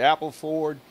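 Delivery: usual speaking voice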